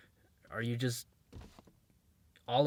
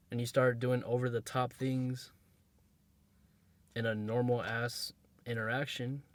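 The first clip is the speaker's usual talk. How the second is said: ordinary talk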